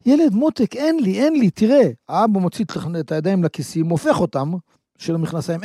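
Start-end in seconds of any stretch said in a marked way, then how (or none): 0.0-1.9 higher, soothing apologetic father; calm the child while refusing; coaxing father. Acted. Father telling his begging son there is no candy, higher soothing voice. Father insists he has none.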